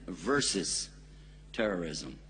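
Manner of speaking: VERSUS set apart, then a pause and terrorism dropped low; "versus" held up clearly before a low "terrorism"; stress on versus. Shown as a demonstration. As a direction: lean on "versus", let "terrorism" fall away. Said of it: "I want you to be sure you understand".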